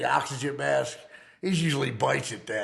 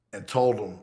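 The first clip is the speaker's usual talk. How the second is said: usual speaking voice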